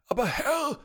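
high, loud little servant voice; a timid servant piping up high; nervous servant squeak; squeaky servant. A narrator's reading. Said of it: "sagte der kahlköpfige Diener".

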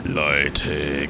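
low, growled call; growling giant. A narrator's reading. The giant warns the people, growling. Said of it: "knurrte der Riese".

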